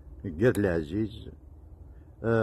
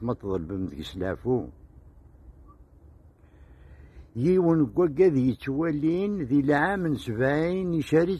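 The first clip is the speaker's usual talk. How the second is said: lilting, measured verse delivery; poem recitation; 5 semitones higher than usual. Acted. Poet reciting his poem to his host, declaimed in verse. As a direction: recite the verses with rising and falling cadence.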